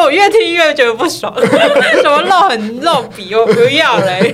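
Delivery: high, loud laughing complaint. Real, not acted. Annoyed retelling, laughing.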